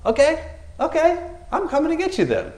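high, light mocking voice; mock challenger. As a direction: tease the enemy while crawling up. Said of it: "he's like".

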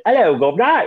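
high, hammed-up British accent; fake British accent. Host mocking a housemate who claimed to be from Britain, fake British accent. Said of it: "She's just faking a British accent".

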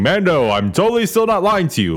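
high, bright sing-song fib; mock shifty promise. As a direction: sell the lie with a wide-eyed lilt. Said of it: "makes a statement saying".

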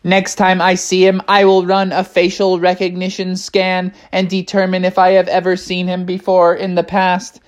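monotone robot voice; robot bear. Acted. Techno Bear promises Bubby a scan, robotic monotone. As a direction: recite it on one unvarying note. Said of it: "Techno Bear was like".

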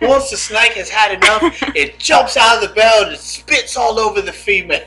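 mock Aussie wildlife host; excited nature-show commentary with a laugh; hammed-up wildlife presenter; Steve Irwin. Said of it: "I just imagine you steamer winning her".

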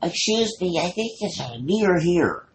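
put-on high, prim little old lady. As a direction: put on a sweet old lady's polite voice. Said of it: "she's like".

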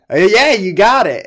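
high, loud, cheery bartender voice; mock bartender. The bartender confirming the pickle juice, high and loud. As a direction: play the bartender, high and chipper. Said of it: "he was like".

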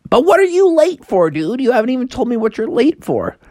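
high-pitched, exasperated questioning; insistent puzzled frog. Acted. Froggy presses the rabbit.

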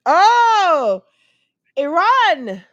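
loud, drawn-out "oh" rising high; loud realisation. Real, not acted. Host finally understands the caller's country, loud surprised realisation. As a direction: exclaim it as it finally clicks.

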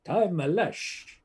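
imitating an echoing film title voice-over; Italian title announcer with echo. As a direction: announce it grandly, then echo the last word, fading away. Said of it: "They put an echo on".

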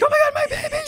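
panicked scream. Retold or imitated. Mock panicked parents in crowd.

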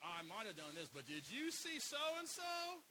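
mocking gossip.

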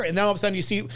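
ordinary talk, nothing marked

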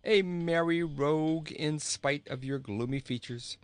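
laughing. A narrator's reading. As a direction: tail end of laughter in the words. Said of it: "laughed the king".

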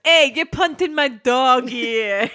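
loud, drawn-out mock outrage; angry dog owner. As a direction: holler it slowly as a wronged dog owner. Acting out angry dog owner's outburst.

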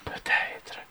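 soft whispered maybe; whispered aside. Real, not acted. Admitting to his co-host he may have dozed off, whispered. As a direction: breathe the word out, barely voiced.

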